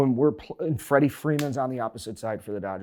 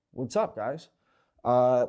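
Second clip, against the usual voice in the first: usual speaking voice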